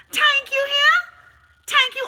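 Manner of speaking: very high, loud, hammed-up joy; mock ecstatic thanks. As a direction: squeal the thanks like a fake overjoyed believer.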